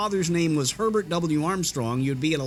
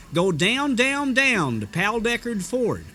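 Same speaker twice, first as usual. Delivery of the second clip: slow, drawn-out radio announcer read of "Go down, down, down to Pal Deckard Ford"; hammed-up radio announcer; artificial radio accent; 4 semitones higher, slower than usual. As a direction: sell it like an old car-dealer radio ad. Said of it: "incredibly artificial radio accent".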